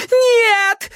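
high desperate howl; howling girl. A narrator's reading. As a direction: wail like a hunted dog. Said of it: "взвыла девица".

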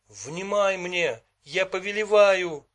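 high, loud, slow command; insistent command. A narrator's reading. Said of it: "не унимался Мендрейк".

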